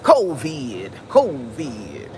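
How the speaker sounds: breathy put-on Southern voice, falling away; Supreme. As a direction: imitate a Southern friend, stretching each word. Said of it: "Wait, let me get".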